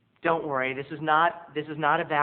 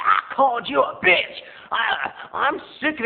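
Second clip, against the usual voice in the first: higher, louder shout; clerk shouting; 7 semitones higher, 5 dB louder than usual. Retold or imitated. Clerk yelling back at mom, loud high shout. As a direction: yell it back fed up. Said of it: "He said".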